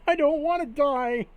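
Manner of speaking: very high, loud, hammed-up wail; panicked pleading. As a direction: scream for your life in mock terror. Son fears death by slime.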